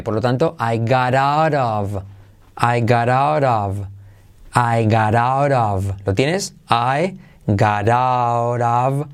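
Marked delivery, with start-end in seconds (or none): 0.6-5.9 "I got out of" slow, both t sounds flapped as soft r. Shown as a demonstration. Teacher repeats "I got out of" slowly with flapped t's. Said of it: "T como R suave". 6.7-9.1 "I got out of" stretched far out, t's flapped; very slow demo. Shown as a demonstration. Teacher drags "I got out of" out for the class. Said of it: "R suave".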